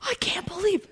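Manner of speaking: high, hushed imitation of amazement; careful disbelief. Parent carefully telling son of disbelief, hushed and high. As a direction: catch yourself in a soft, high voice. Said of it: "I had to be careful when I said".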